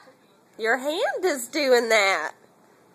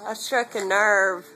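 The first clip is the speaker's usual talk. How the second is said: usual speaking voice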